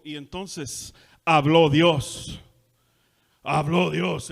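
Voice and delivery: loud, slow proclaiming of habló Dios; preacher's booming cry. Retold or imitated. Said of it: "después de cada frase dijo".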